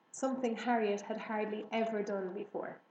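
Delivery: ordinary delivery, nothing marked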